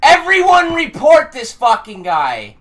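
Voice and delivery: ordinary talk, nothing marked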